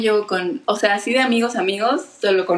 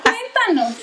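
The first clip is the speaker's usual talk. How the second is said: nosy aunt; 12 semitones higher, slower than usual. Retold or imitated. Mimicking the nosy relatives.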